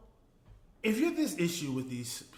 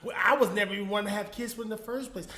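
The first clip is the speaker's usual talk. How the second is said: mock indignant quote; 7 semitones higher, 5 dB louder than usual. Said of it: "and then be like".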